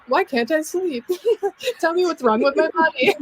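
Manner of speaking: hammed-up sleepless fretting with a laugh; mock desperate. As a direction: whine it at yourself in comic despair, breaking into laughter. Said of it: "when I can't sleep is just like".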